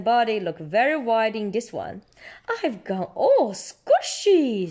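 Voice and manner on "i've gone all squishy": slow, drawn-out silly voice; Danny's voice. A narrator's reading. Parent voicing Danny at the mirror, drawn-out and silly. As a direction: stretch it out comically. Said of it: "says Danny".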